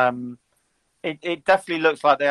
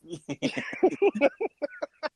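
high laugh; 13 semitones higher, 4 dB quieter than usual. Real, not acted. Amused by banter.

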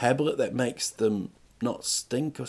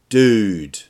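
loud, drawn-out address; imagine hailing someone, loudly; calling out; 2 semitones lower, 9 dB louder than usual. Pretend. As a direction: pretend confrontation, one loud word. Imagined confronting of the dog walker.

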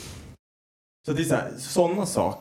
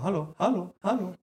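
higher, sing-song calls for attention; calling out; 7 semitones higher than usual. Retold or imitated. Parent ignored by kids calling for attention, higher. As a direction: call out trying to get noticed. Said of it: "Man bara".

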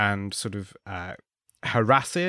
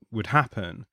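ordinary talk

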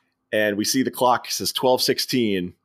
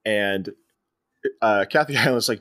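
ordinary talk, nothing marked

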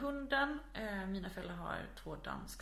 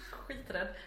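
usual speaking voice

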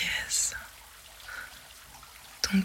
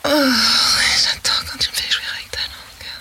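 rising ecstasy; 3 semitones higher than usual. Acted. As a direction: let it climb until you cry out. Roleplay building to climax.

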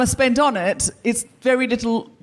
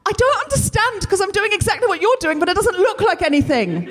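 higher, wailing frustration; mock-despairing high whine; exasperated whine; 9 semitones higher than usual. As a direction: complain like you're near tears, pitched up. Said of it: "I could be in tears over it".

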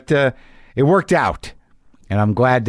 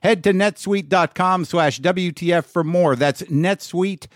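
upbeat sponsor read, higher pitch; 6 semitones higher than usual. Acted. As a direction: read it like a podcast ad. Reads a sponsor ad.

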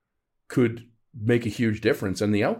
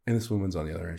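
ordinary talk, nothing marked

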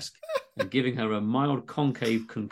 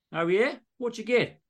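high, curious Aussie doc; exaggerated Aussie accent; 9 semitones higher than usual. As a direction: doc asking about phone, Aussie. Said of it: "all dialogue is in exaggerated Aussie accents".

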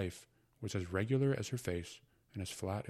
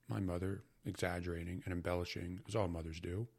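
ordinary delivery

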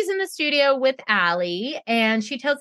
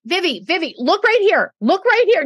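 put-on higher, louder, drawing a child's eyes; coaxing coach; 6 semitones higher, 6 dB louder than usual. Coach getting Vivi's attention, higher and louder. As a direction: call a little girl's attention, firmly. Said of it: "she's like".

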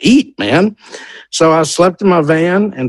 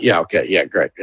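low deadpan; 11 semitones lower, 5 dB quieter than usual. Retold or imitated. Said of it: "And he's like".

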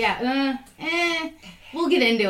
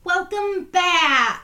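excited welcome; 9 semitones higher, 7 dB louder, much slower than usual. Real, not acted. Hosts greet listeners.

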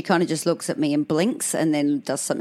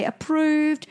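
hoping for approved; 10 semitones higher than usual. Retold or imitated. Card machine awaited.

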